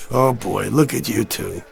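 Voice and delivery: low, slow and soft after a sigh; weary sigh. Acted. Man looking at the young couple, weary sigh.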